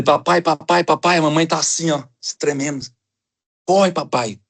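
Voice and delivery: put-on a scared boy running to his dad; frightened child.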